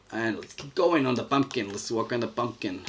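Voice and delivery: cartoonish New York-Italian-Mexican accent; funny hybrid accent for a cartoon character; made-up mixed accent. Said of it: "what kind of accent is that".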